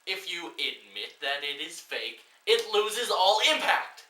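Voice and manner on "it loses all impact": high, loud, drawn-out rant; slow outraged shout; slow furious emphasis. Real, not acted. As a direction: spell out each word in exasperation. Ranting at the film.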